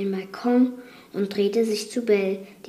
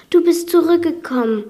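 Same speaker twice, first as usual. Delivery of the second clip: higher, airy words of the wounded; weakly breathed; 7 semitones higher, 5 dB louder, faster than usual. A narrator's reading. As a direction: breathe it out with effort. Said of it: "hauchte das Biest schwach".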